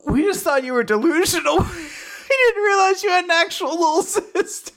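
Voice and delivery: voiced friends cracking up mid-sentence; laughing disbelief. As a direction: giggle through the apology. Friends laugh at Lance's claim.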